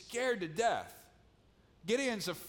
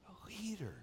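hushed, breathless whisper; 3 semitones higher, 8 dB quieter than usual. Real, not acted. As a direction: breathe the word like a secret. Preacher's hushed climax.

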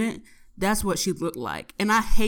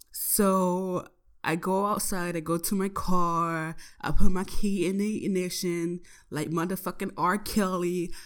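usual speaking voice

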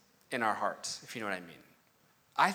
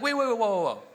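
startled, higher objection; surprised; 4 semitones higher than usual. Retold or imitated. Reacting to the verse, startled and higher. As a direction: stop short as if caught off guard. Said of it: "I'm like".

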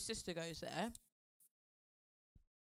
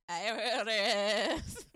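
put-on very slow, louder old granny; 8 dB louder, much slower than usual. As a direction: draw it out like a granny. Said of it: "So just my granny voice".

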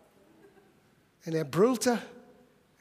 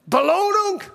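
excited cry; 7 semitones higher, 6 dB louder than usual. Retold or imitated. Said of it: "Davids erster Gedanke war".

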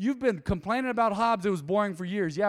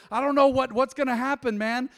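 worried congregant; 5 semitones higher, 5 dB louder than usual. Retold or imitated. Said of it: "You say".

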